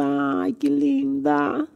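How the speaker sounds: slow, lilting gush of delight; swooning admiration. Real, not acted. Vlogger spots a lovely plant, drawn-out swoon. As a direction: sigh it out, stretching the words.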